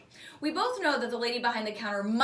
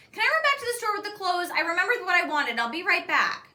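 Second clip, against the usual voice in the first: higher, louder, urgent asking; eager pleading girl; 8 semitones higher, 6 dB louder than usual. A narrator's reading. Livy begs her mom to let her run back, urgent and louder. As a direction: rush the request, desperate to go. Livy begging to leave, voiced.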